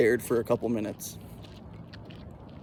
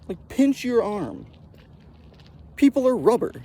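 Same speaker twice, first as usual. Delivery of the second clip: put-on know-it-all kid; 7 semitones higher than usual. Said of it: "was like".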